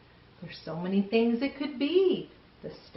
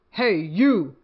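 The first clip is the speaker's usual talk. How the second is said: loud, bossy challenge; picking a fight with a gorilla, loud and grouchy; grouchy ladybug; 7 dB louder than usual. Acted. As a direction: call out the bigger animal, louder. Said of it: "said the grouchy ladybug".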